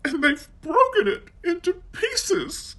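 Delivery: put-on very high baby bear cry; Baby Bear finds his chair used, very high; cried. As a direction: wail it as the tiny bear. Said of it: "he cried".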